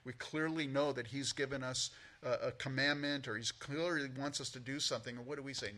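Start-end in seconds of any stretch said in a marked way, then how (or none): none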